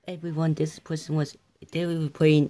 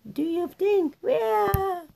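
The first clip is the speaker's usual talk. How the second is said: high character voice; 13 semitones higher than usual. Acted. Voicing a character.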